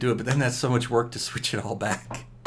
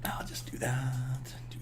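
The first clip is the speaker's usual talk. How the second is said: whispered aside; 10 dB quieter than usual. Real, not acted. Gamer quietly deciding, whispered aside. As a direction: murmur it to yourself.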